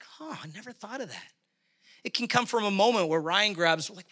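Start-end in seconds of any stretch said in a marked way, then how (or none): 0.0-1.3 very soft acted realization; quiet musing. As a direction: murmur it as if just struck by a thought. Acts out a listener's quiet realization.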